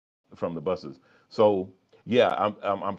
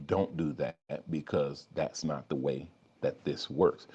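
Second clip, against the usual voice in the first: calm quiet voice; 4 semitones lower than usual. Pretend. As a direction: talk them through it like a patient guide. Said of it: "the calm voice".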